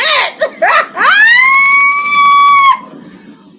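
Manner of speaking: screaming with laughter, high and loud; losing it over the dragon; wild shrieking laugh. Real, not acted. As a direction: let out a huge delighted shriek-laugh. Reacting to dragon scene.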